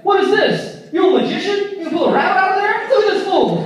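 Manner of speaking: high, loud mock ribbing; playful teasing. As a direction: rib a buddy in a hammed-up excited voice. President teases band member about flower.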